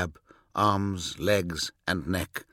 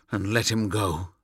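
plain reading voice

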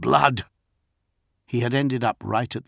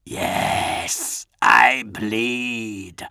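rasped, drawn-out villain voice; menacing rattle from a dying throat; death-rattle rasp; much slower than usual. A narrator's reading. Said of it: "rasped a death rattle voice".

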